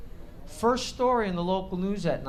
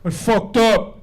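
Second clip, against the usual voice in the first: son confessing; 4 semitones higher, 11 dB louder, slower than usual. Retold or imitated. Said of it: "What's up?".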